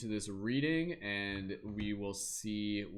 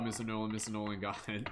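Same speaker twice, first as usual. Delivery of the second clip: ordinary talk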